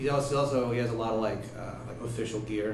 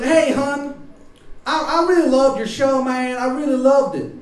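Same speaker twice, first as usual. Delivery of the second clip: loud, high-pitched bad Baltimore accent; shitty Baltimore accent; 11 dB louder than usual. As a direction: holler it in a terrible, exaggerated Baltimore accent. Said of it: "That's what his accent sounds like".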